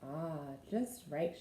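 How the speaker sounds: soft, low, sighing relief; contented sigh. A narrator's reading. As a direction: settle in with a sleepy sigh. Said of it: "she sighed".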